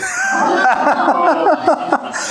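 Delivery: a loud, high shriek of real laughter; laughing hard in disbelief; high burst of laughter. Real, not acted. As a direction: crack up at the memory. Laughing at own story.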